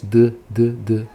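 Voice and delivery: repeated short "duh"; voiced D sound. Shown as a demonstration. Teacher showing D needs vocal cords, voiced duh sounds. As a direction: tap out the bare consonant D with voice. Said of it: "sem usar as cordas vocais".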